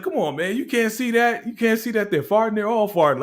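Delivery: put-on high, pleading little boy voice; pleading kid. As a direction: beg the lady to see it like a kid. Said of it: "he's really pleading his case".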